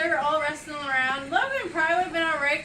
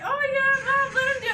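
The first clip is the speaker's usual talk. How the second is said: put-on eager kid begging; 10 semitones higher than usual. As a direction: beg mom like an excited boy. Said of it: "But Liam's like".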